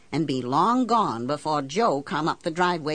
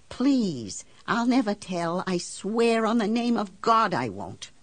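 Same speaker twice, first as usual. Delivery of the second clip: higher-pitched, earnest begging; imploring promise; desperate pleading; 5 semitones higher than usual. Acted. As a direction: beg him and swear secrecy. Begs banker to tell.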